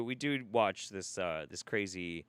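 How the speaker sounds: ordinary delivery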